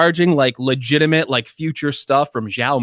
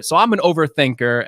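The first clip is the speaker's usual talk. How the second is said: ordinary delivery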